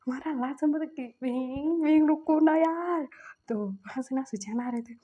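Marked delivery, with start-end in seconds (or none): 1.2-3.1 higher, louder, slow pleading call. Retold or imitated. Husband calling after his upset spouse, pleading call. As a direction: stretch it out to stop him leaving.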